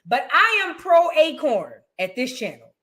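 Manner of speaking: normal talking voice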